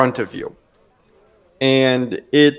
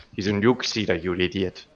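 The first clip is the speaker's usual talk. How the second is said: softer, scolding idiot jab; scolding dwarf; 5 semitones lower, 9 dB quieter than usual. Acted. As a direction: chide him softly, like a tired brother. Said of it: "slaps his head softly and says".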